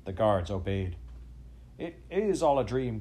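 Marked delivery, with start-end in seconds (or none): 1.8-3.0 higher, louder cowering cry; old banker cowering behind his clerk, afraid; frightened cry. A narrator's reading. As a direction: cry out in fear from the doorway. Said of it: "cried Mister Levinstein".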